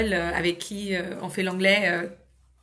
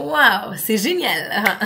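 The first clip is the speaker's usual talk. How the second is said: loud "waouh", then a slow, laughing "c'est génial"; delighted praise; 6 dB louder, much slower than usual. Shown as a demonstration. Teacher praising a child's creative mistake, excited. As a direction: gush with delight at the child's try. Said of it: "je vais dire".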